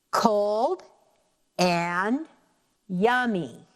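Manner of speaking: long pauses between COLD, AND and YUMMY; one beat at a time, "cold... and... yum-my"; tapped-out syllables. Shown as a demonstration. Teacher tapping out four syllables for students, slowly separated. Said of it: "I'm gonna tap out using my pointer".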